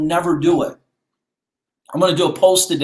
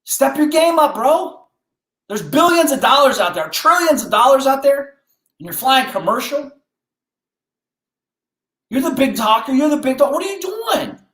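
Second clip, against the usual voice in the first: self-scolding rant; 8 semitones higher than usual. Retold or imitated. Evans scolding himself on a commercial plane, rising into a shout. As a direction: chew yourself out like a tough coach.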